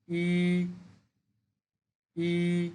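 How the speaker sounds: ই rising upward; Mandarin second tone. Shown as a demonstration. Teacher shows the second tone on ই, rising. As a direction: push ই up in pitch. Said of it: "second tone উপরের দিকে উঠে যাবে".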